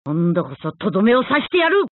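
fast threat climbing from low; battle threat. Acted. Fighter vowing to finish off an enemy, fast rising threat. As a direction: vow the finish, rising to the last word.

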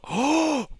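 long, high-pitched gasp; shocked gasp. Real, not acted. Player seeing guards take out people, shocked gasp.

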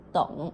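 tụng said as Mandarin dǒng with the mouth kept open, no closing; low tụng, mouth not closed at the end. Shown as a demonstration. Teacher shows students to keep the mouth open on tụng. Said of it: "không có được đóng miệng lại nha".

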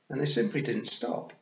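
plain reading voice

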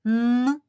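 loud, held Н alone; Н as a bare nasal sound, not a letter name; isolated sound Н. Shown as a demonstration. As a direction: hum out a strong Н. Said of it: "Який же останній звук".

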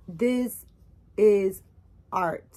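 THIS, IS, ART each set apart by pauses; "this... is... art", loud and word by word; emphatic declaration. Real, not acted. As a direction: punch "this", "is", "art" as separate beats. Solemnly declaring the doll is art.

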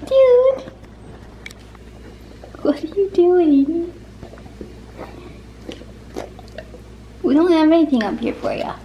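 high, loud teasing dog talk. Real, not acted. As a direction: playfully scold the begging dog. Dog at table.

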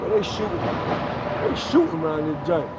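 hushed alarm. Real, not acted. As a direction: drop your voice as if shots are close. Hears gunshots during outdoor video.

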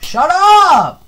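high furious yell; real annoyed shout at the janitor; angry shout. Real, not acted. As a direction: scream it at the sweeper. Yelling at the sweeper.

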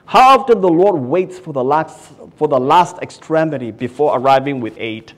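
loud preaching build; fervent pulpit call; preached. Acted. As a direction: drive the line home to the crowd. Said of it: "Phillips writes in the commentary".

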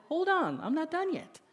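a hint of a stubborn kid cutting in; little girl voice.

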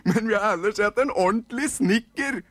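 put-on high, loud squeaky kid voices; nisse kids shouting. Kids answering their mother, high excited shout. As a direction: protest like eager little elves. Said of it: "ropte unga".

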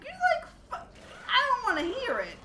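high, loud, sputtering refusal; sputter, then shut him down; retold mock outrage; exasperated protest. Retold quarrel, cutting him off.

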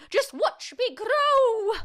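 high, loud, drawn-out screech; boastful shout. A narrator's reading. Grandma shows off her growing, boastful screechy shout. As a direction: crow it slowly at full voice. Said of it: "she shouted".

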